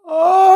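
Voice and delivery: high, loud drawn-out "ah"; sudden sustained high wail; loud cry. Acted. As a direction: cry out long and high at full volume. Sudden cry amid talk of hurt.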